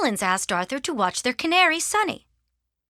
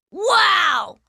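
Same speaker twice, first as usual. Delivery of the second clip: high, loud, drawn-out exclamation; thrilled child's wonder; amazed; 9 semitones higher, 6 dB louder than usual. Acted. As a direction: marvel out loud. Arthur gets puppy and money.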